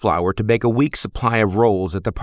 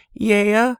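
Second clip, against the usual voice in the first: put-on a lightly higher, blunt young woman; Lizzie, girl's voice; 12 semitones higher, 4 dB louder than usual. Stable girl answers a stranger, lightly higher blunt voice. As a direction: toss it back, squinting into the sun.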